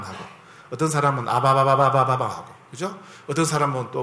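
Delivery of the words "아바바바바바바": babbling tongues. Retold or imitated. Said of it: "아이들 옹알이처럼 해요".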